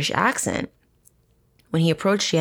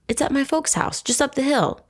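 falsely innocent, pitched high; sweet fake reassurance; 9 semitones higher than usual. Ted lying to the woman.

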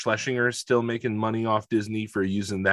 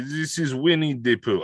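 put-on Russian accent; 4 semitones higher than usual. Describing the Soviet brown bear Pooh, mock Russian accent. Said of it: "I'm doing it again".

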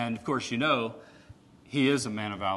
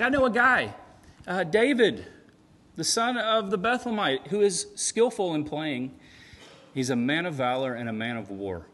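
higher, louder, eager pitch to the king; eager advisor; 5 semitones higher, 4 dB louder than usual. Retold or imitated. Advisor eagerly recommends David to Saul. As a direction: sell the king on a great find. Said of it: "he's like".

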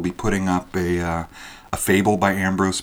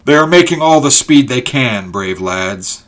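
raised, gladdened voice, easing at the end; glad; 6 semitones higher, 11 dB louder than usual. A narrator's reading. Ulrich praising the men racing to help, glad. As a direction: start high and loud, then settle proudly. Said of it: "said Ulrich gladly".

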